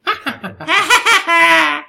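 high loud witch cackle. Pretend. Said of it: "witches' laughter? Is it called cackle?".

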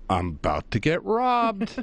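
high, drawn-out comic dread; retold panic, pitched right up and slowed; mock alarm. Teller relives being shut in the store, mock alarm. His thought on being trapped inside.

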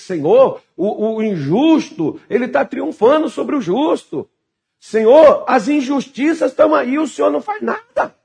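high-pitched indignant complaint to God; oração de revoltado. Acted. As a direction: plead in revolt, pitch climbing to the end. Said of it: "parece uma oração de revoltado". (clamor revoltado)

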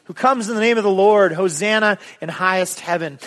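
joyful shout of welcome; crowd shouting. Acted. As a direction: call it out like the cheering crowd. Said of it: "those that followed shouted".

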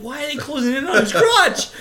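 high-pitched incredulous question; alarmed bafflement. Retold or imitated. Viewer watching the camera close in, alarmed bafflement. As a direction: protest it in comic disbelief. Retells own baffled reaction.

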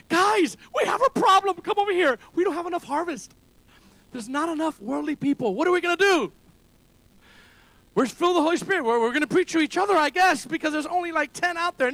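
hammed-up worry about too little harvest; a lightly voiced panicked disciple; mocking, incredulous voice. Mock Jesus complaining about harvest.

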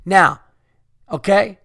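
normal talking voice

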